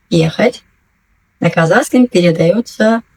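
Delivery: usual speaking voice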